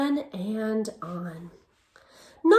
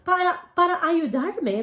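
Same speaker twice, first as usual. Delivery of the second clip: ordinary delivery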